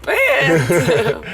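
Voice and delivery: laughing exclamation; bursting out laughing. Real, not acted. Laughing reply.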